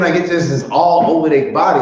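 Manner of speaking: ordinary delivery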